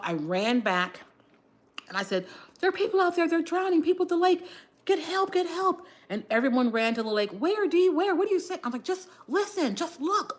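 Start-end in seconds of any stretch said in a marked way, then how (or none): 2.6-5.9 much higher, urgent retold alarm; frantic alarm. Telling everyone people are drowning, high frantic alarm. 7.3-8.6 urgent question. Retold or imitated. Others asking urgently. 8.8-10.4 urgent urging. Retold or imitated. Urging others at lake.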